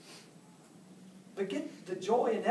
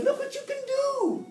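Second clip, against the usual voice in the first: much higher, delighted praise; excited praise; 5 dB louder than usual. Retold or imitated. Dad cheering his son after a catch, excited and high. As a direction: cheer the boy on like a proud dad. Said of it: "And I said".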